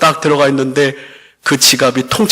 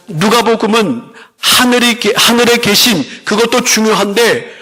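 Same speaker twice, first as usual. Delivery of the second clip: raised, ringing pulpit voice, pressing the point home; preaching; 6 semitones higher than usual. Acted. Pastor to congregation about the loving Father, preaching with fervor.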